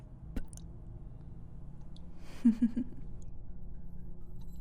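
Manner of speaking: long whispered chuckle; breathy villain laugh. Acted. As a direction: laugh at the thought of the blade. Villain relishing cruel game.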